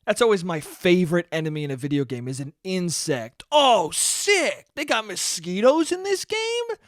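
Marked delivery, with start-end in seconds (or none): 3.5-6.8 high, hammed-up gamer thrill; sarcastic fake excitement; mock excitement. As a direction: gush like a delighted fan.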